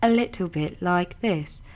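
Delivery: slow British RP satnav voice; British robot reading out directions, slowly; British GPS robot. Shown as a demonstration. Voice actor demonstrating two combined styles, British RP robot. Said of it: "putting the two together sounded".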